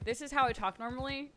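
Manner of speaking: ordinary talk, nothing marked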